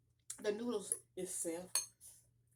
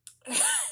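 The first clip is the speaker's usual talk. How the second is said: burst of laughter; 10 dB louder than usual. Real, not acted. Reacting to the heat.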